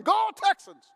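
loud, high stadium cheer; a faithful Texans fan shouting from the seats. Retold or imitated. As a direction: cheer like a die-hard fan in the stands. Fan cheering losing team.

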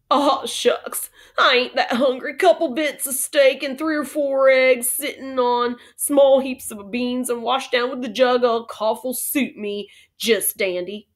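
slow, hearty cowboy drawl; cowboy, brightened. Acted. Boone ordering a big breakfast, cheerful drawl. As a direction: roll out the order like a cowhand.

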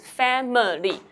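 "Family" spelled out loudly as FAM-I-LY; loud, over-enunciated "Family" with middle vowel kept; three clear syllables. Shown as a demonstration. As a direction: beat out each syllable of "Family". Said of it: "it's three syllables".

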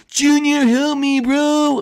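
trapped Gil calls to Junior; Gil pleading. Acted.

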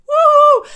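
high, loud "woo-hoo"; exaggerated cheer at full volume; mock party whoop. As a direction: let out a mocking, high-pitched whoop. Mimics the boss being too laid-back.

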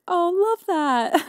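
high, slow, breezy celebrity voice; imitating a celebrity's high, slow, gushing reply; James Charles impression. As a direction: drag it out high and sweet. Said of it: "And he was like".

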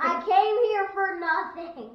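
high, loud, slow moan; loud whine. Real, not acted. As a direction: wail the complaint. Repeated louder whine.

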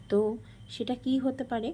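ordinary talk

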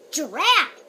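loud straining grunt; effortful grunt. Acted. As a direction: heave like pulling hard. Vampire straining at the pumpkin.

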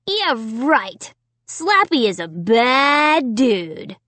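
slow, drawn-out mocking drawl; grinning taunt. A narrator's reading. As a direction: chime in with a teasing grin, stretching the words. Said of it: "Jed chimed in, grinning".

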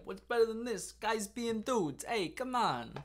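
high-pitched, loud put-on Jersey guy; New Jersey-style accent. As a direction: quote the Vine in a hammy New Jersey accent. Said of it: "what this accent I'm doing here is".

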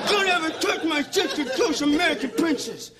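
high-pitched mock fury; hammed-up high protective outrage; angry warning. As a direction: yelp it out in the middle of a brawl. Acts out beating the boyfriend.

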